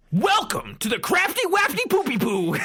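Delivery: high, goofy hammed-up announcer voice. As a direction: ham it up like a clown presenting a prize. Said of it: "He's like".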